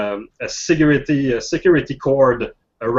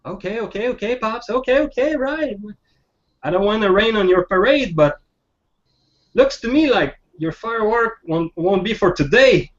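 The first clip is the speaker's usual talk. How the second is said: put-on high-pitched kid giving in, then needling; teenage kid; 8 semitones higher than usual. Teen humouring Pops about his fireworks, high kid voice. Kid answers the old man in role.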